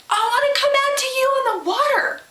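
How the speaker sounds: high, fast and eager; eager Peter. Retold or imitated. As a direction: blurt it like an impatient kid.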